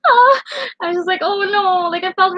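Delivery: high, tearful oh no; choked up remembering her friend; real tears in the voice; crying. Real, not acted.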